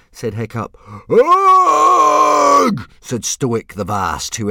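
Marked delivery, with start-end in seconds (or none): 1.1-2.9 long, high, loud bellow; shouted groan of disgust. A narrator's reading. Father enters the messy room, loud disgusted bellow. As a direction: bellow it in one long burst.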